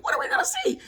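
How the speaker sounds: very high, loud, playful prodding; insistent parent shout. Retold or imitated. Parent presses son, escalating.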